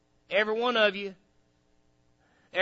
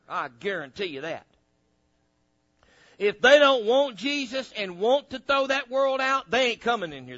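usual speaking voice

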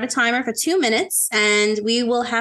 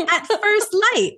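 lightly theatrical, pitched up; playfully dramatic flourish; grand announcement; 9 semitones higher, 4 dB louder than usual. Shown as a demonstration. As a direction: present it like a fancy phrase. Presenting the fancy phrase grandly.

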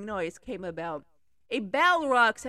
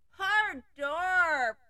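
mumbled pity; 6 semitones higher than usual. Acted. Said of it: "mumbled Gwenelle".

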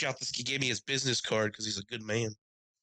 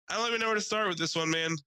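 mock exasperation; 9 semitones higher than usual. Exasperated to rental clerk.

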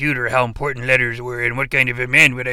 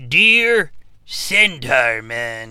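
drawn-out, deliberate letter greeting; slow reading; 5 dB louder, much slower than usual. Acted. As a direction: read each word out very slowly. Rereading letter greeting slowly.

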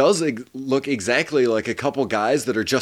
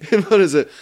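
ordinary talk

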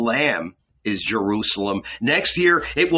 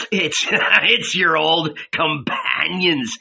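slow, higher, laughing through the words; barely held laughter; 4 semitones higher, slower than usual. A narrator's reading. The Negro teasing Jesus, barely holding back laughter. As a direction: let the laugh break out mid-line. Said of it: "hardly able to restrain his laughter".

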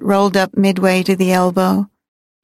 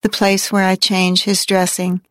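usual speaking voice

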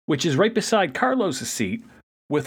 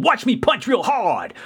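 imitating an eager little kid, pitched higher; a hint of a boastful child, higher pitched; lightly voiced three-year-old; 7 semitones higher than usual. Hotshot showing off to Scavenger, lightly voiced three-year-old. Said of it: "acting like a three-year-old".

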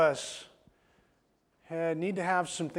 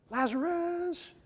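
drawn-out answer; 12 semitones higher, much slower than usual. Acted.

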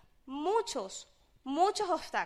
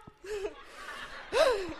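delighted laugh; 6 semitones higher than usual. Real, not acted. Laughter after the cheer.

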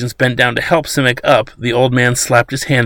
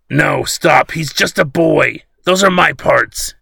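higher, slower desperate plea; pleading; 5 semitones higher, slower than usual. A narrator's reading. Old man on the ground begs the captain to spare the boy, pleading. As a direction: beg them to stop, voice climbing. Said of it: "he pleaded".

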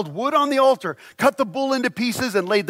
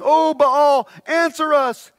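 high, loud cry to a god; shouting invocation; 7 semitones higher, 3 dB louder, slower than usual. Acted. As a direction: call out to Baal, pitched way up.

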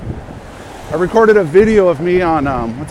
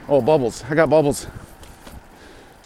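talking through hiccups; breaking off with hiccups between words; slower than usual. Real, not acted. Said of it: "I got the hiccups".

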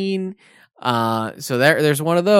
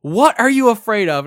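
higher and louder, demanding dad; angry dad; 7 semitones higher, 6 dB louder than usual. Retold or imitated. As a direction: snap at the scared kid like an angry father. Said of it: "he is pissed".

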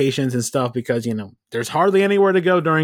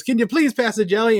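higher-pitched country twang; 7 semitones higher than usual. Retold or imitated. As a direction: put on a real country voice, imitating the ad. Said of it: "goes with the real country voice".

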